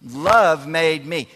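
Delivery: Love made me stretched very slowly; very slow emphasis. Shown as a demonstration. Pastor repeats it to the students, very slow and firm. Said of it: "Say it with me".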